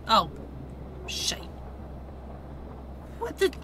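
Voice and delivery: ordinary talk, nothing marked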